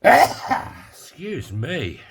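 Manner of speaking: a real sneeze, then excuse me; sneezing. Real, not acted. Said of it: "I'm gonna sneeze".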